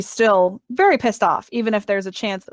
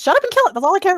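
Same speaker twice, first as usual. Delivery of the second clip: higher, louder, fast repeat; louder fast insistent; 9 semitones higher, 5 dB louder, much faster than usual. Real, not acted. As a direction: snap it out again, pushier. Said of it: "repeated louder".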